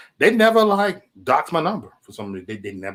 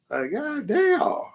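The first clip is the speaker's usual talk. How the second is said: drawn-out disbelief; 13 semitones higher, much slower than usual. Real, not acted. Reacting to perverts in chat.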